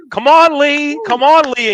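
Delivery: loud, high cheer from the bleachers; cheering mom. Retold or imitated. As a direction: call it out like a proud mom in the stands.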